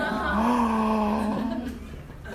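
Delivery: high gasp of amazement; sharp surprised gasp. Retold or imitated. Preacher meeting the woman from Mongolia, amazed gasp. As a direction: catch your breath in shock. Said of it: "And I went".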